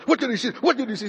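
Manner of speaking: high-pitched mock yell; imitated yelling. Grandfather pressing his grandson, imitated high yelling. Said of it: "he kept yelling louder, louder, louder".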